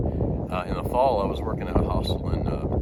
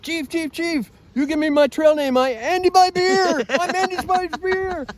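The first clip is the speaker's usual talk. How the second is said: put-on much higher, louder, slow excited German-accented voice; excited German hiker; 6 dB louder than usual. Hiker greeting the chief at the gear shop, excited. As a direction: beam with pride, drawing it out. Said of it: "he said".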